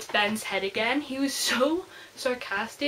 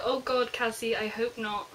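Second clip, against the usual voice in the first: ordinary talk, nothing marked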